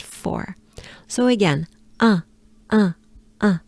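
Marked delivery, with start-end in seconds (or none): 2.0-3.6 short, clipped-off repeats of French "un", each on its own; French nasal vowel demo. Shown as a demonstration. Teacher isolating a sound for learners, French "un" demonstrated. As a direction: say "un" as a lone French nasal vowel, with pauses between. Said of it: "this is the sound".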